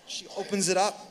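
ordinary talk

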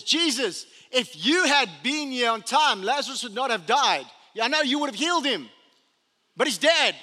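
a sister blaming Jesus to his face; grieving sister. Acted. Martha reproaching Jesus for coming late, loud and accusing.

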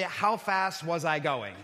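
retold slow admission; confessing. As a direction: own up sheepishly, drawing it out. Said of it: "you can confess and say".

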